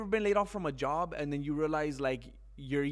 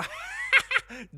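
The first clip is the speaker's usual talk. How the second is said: very high loud cackling laugh; loud high mocking cackle; gloating laugh; 8 dB louder than usual. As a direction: cackle like a villain.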